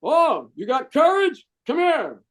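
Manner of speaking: high, slow drill instructor challenge; a hint of a barking DI. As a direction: taunt the cocky recruit, slowly. Drill instructor answers the recruit.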